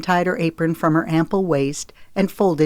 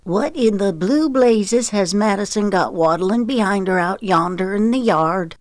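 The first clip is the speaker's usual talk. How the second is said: higher-pitched Southern country woman; Gina, astonished; 4 semitones higher than usual. Acted. Gina calls out the kitchen window question, astonished. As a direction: exclaim in a high, folksy Southern voice. Narrator voices Gina, the cook.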